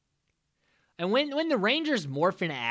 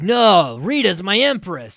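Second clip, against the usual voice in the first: louder hammed-up loyal henchman; defiant villain; 4 semitones higher, 12 dB louder than usual. Evil Tommy refusing Jason, loud mock defiance. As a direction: snap back proudly, full volume. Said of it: "And he's like".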